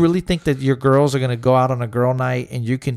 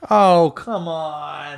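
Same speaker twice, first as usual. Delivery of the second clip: scoffing; 4 semitones higher, much slower than usual. Real, not acted. Scoffing at excuse.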